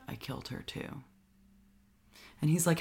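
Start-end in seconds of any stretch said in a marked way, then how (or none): 0.1-1.0 deadpan quote. Retold or imitated. Quoting her flat confession.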